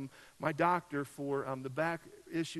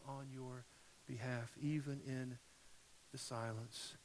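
tender God voice; 7 dB quieter than usual. Pretend. God's gentle reassurance, softening.

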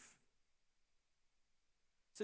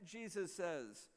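ordinary delivery

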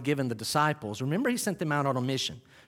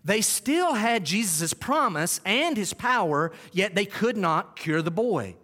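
slow, higher, pressed on still; repeated with emphasis; 4 semitones higher, slower than usual. Shown as a demonstration. Preacher repeats the quote slowly for emphasis. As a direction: drive home "still" and "could not". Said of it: "That needs repeated".

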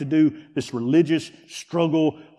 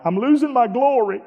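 mock crying; 8 semitones higher, 4 dB louder than usual. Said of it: "they come to me crying".

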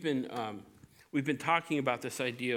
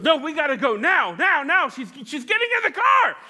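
much higher and louder, urgent pleading; frantic frontal lobe; 11 semitones higher, 9 dB louder than usual. Acted. Frontal lobe hurrying the boy before the girl drives off, frantic and loud. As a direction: rush him, she's leaving now. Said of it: "the frontal lobe goes".

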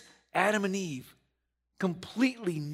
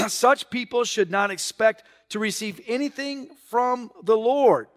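loud, high preaching voice; fired-up pulpit delivery; preaching at full force; 6 semitones higher, 7 dB louder than usual. Acted. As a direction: drive the scripture home to the church.

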